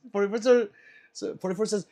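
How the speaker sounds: normal talking voice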